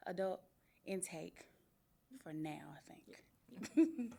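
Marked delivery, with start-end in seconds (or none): 2.8-4.1 whispered. Real, not acted. Unsure whisper after intro.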